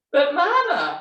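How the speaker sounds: high cub's plea; protesting Baby Bear. A narrator's reading. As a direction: plead like a little cub.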